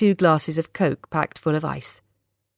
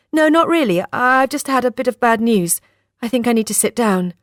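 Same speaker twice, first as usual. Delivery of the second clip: higher-pitched, dazed admission; dazed, unguarded; 6 semitones higher than usual. A narrator's reading. Shaken woman admits bad news to Anthony, dazed and unguarded. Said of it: "feeling dazed and, for the moment, unguarded".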